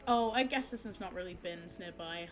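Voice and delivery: ordinary talk, nothing marked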